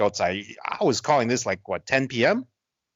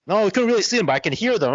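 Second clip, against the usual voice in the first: mock girl voice; 8 semitones higher, 3 dB louder, faster than usual. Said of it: "she's like".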